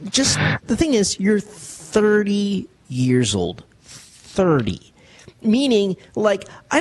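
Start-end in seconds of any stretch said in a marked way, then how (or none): 1.2-4.9 "thirty" drawn out slowly and set apart; stress on thirty. Real, not acted. Host confronts caller living with his mom, slow emphasis on "thirty". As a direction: hammer "thirty" slowly, then repeat it alone.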